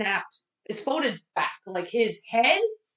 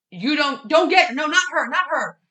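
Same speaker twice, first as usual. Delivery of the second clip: desperate plea; 5 semitones higher, 5 dB louder than usual. Retold or imitated. Retelling dad's desperate plea.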